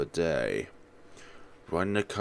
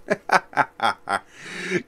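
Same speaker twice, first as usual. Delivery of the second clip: gleeful high laugh; 11 semitones higher, 7 dB louder than usual. Acted.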